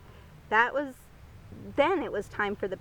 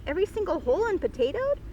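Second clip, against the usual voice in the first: fast, higher, startled question; surprised; 7 semitones higher, 3 dB louder, faster than usual. Acted. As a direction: echo it back in disbelief. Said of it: "What are you saying".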